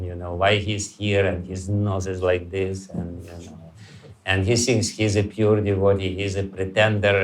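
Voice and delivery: low, scornful muttering about others; sneering inner voice. Retold or imitated. Mocking envious thoughts about devotees.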